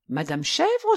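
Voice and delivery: high-pitched surprised question; astonished. A narrator's reading. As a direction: echo it in surprise, pitched well up. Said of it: "s'étonna le prince masqué". (étonné, voix haut perchée)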